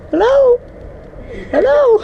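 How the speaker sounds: squeaky falsetto call, teasing; sing-song high hello to tease. Acted.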